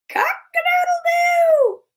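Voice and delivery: very high, slow cock-a-doodle-doo crow; rooster crow. Acted. Reader showing kids how animals say good morning, rooster crow. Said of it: "That's how you say good morning".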